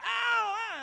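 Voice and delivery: sudden high yelp of pain; high pained cry. Retold or imitated. Preacher as a four-year-old yelps in pain. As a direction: cry out sharp and high like a hurt child.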